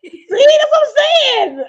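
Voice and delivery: loud, high, slow laughing cry; excited laughing yell; laughing shout. Real, not acted. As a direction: burst out laughing in agreement.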